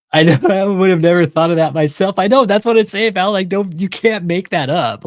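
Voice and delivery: laughing. Real, not acted. Laughing high exclamation.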